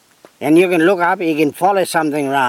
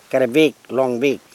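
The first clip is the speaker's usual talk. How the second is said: usual speaking voice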